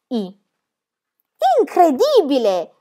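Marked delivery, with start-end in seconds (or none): 1.6-2.7 high, drawn-out exclamation of amazement; astonished child's outburst; exclaiming. Acted. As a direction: exclaim slowly in mock wonder. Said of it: "Esclamò Pippi".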